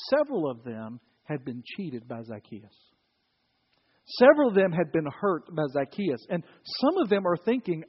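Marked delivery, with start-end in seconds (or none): none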